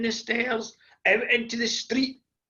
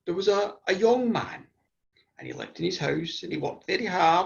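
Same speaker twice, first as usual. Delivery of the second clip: ordinary delivery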